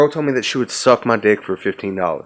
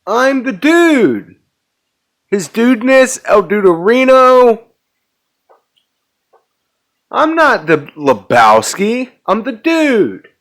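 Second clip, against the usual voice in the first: high, loud hammed-up declaration; mock-grand yelled introduction; shouted movie quote; 11 semitones higher, 8 dB louder than usual. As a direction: proclaim the nickname at a shout, drawing it out. Quoting The Dude, shouting.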